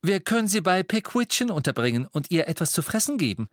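high, bright-spirited cheering-up; mother trying to cheer him up; encouraging. Acted. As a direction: lift him up with a cheery question. Mrs Weasley continues cheerfully.

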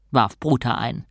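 ordinary delivery, nothing marked

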